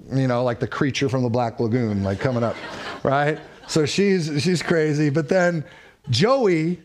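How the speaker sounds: normal talking voice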